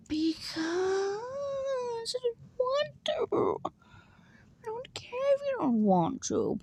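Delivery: put-on very high, slow whining child voice; whiny high voice. Arguing with herself as a stubborn child, high whine. Petulant made-up dialogue.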